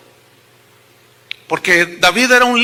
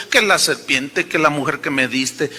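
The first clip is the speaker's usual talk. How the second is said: lightly voiced sulky excuse-maker; mock excuse; 7 semitones lower, 4 dB quieter than usual. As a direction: lower and softer, half-mumbled blame-shifting. Mocking Adam's blame excuse.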